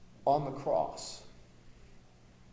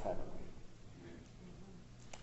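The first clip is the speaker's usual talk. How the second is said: whispered aside; 17 dB quieter, much slower than usual. Real, not acted. Hushed rhetorical aside.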